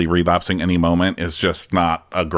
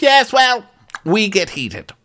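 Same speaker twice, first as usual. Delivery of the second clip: high, theatrical put-on voice; hammed-up haughty retort; Karen impression; 13 semitones higher, slower than usual. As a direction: answer grandly in a raised pitch. Acted reply from Karen.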